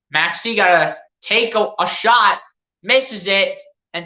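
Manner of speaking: low, easy running commentary; lightly voiced play-by-play. Fan calling his team's game for his viewers, mild play-by-play. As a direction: call the passes like a broadcaster, understated.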